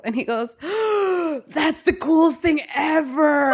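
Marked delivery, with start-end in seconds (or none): 0.6-1.4 high delighted gasp; an imitated gasp of wonder. Date gasps in amazement. 1.5-3.5 slow, higher, laughing amazement; amazed delight. Retold or imitated. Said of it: "the most adorable reaction".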